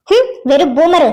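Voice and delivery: slow, dismissive mutter; scornful jibe. Acted. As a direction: throw the insult away slowly. Girl mocks the older man.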